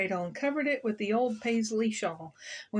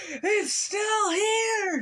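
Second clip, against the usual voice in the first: loud, high, drawn-out outburst; slow outraged storybook shout; furious cry; 12 semitones higher, 5 dB louder, much slower than usual. A narrator's reading. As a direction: cry it in fury, stretching each word. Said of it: "filled with fury".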